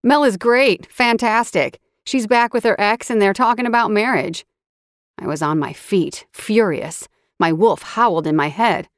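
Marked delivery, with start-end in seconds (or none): 0.0-4.4 sarcastic. A narrator's reading. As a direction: throw the lie at him mockingly. Ben mocks Mac angrily.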